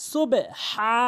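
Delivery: সুবে হা with bounced b and throaty long h; correct pronunciation. Shown as a demonstration. Teacher modelling for learners, সুবে হা bounced and throaty. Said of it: "এভাবে উচ্চারণ করতে হবে".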